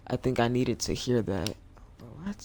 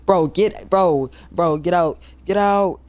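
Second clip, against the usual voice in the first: loud high yell of "bro" and "get out"; 6 semitones higher, 13 dB louder than usual. Real, not acted. Streamer yells at the teen passenger to get out, loud and high. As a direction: shout her out of the cab.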